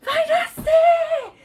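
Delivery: high, drawn-out cry of vai nascer; retold birth cry. Mother in labour crying out to the room, high and drawn out. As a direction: cry out that the baby is coming. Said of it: "me joguei assim pra trás e disse".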